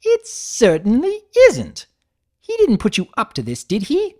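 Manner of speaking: high, loud, indignant refined mare; flustered Rarity. Acted. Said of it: "blushed furiously".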